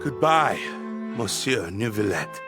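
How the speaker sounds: low-pitched, subdued goodbye; grave farewell. Acted. Condemned man to his judge, grave farewell. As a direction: say a last goodbye, sinking low.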